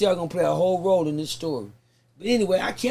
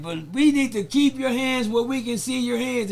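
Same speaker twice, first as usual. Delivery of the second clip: bullhorn cop; 5 semitones higher, 4 dB louder, slower than usual. Retold or imitated. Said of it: "talking to me on a fucking bullhorn".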